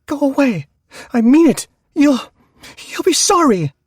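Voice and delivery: high, loud scared threat; frightened yell. Acted. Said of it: "Carol yelled into the floor".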